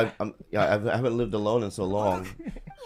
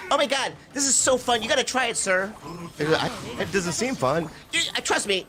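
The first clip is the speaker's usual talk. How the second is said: put-on higher, eager kid voice; excited kid; 11 semitones higher than usual. Kid urging the old man to try the box, higher and eager. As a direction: talk him into it like a gleeful kid.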